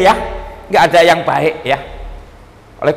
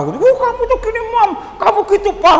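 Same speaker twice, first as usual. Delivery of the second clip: mock quarrel. Quarreling husband and wife voiced.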